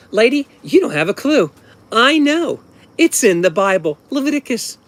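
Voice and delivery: put-on high, loud, indignant kid voice; smug know-it-all kid. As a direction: put the teacher in her place like a little preacher. Kid lecturing teacher about pork.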